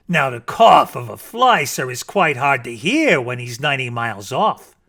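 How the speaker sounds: ordinary delivery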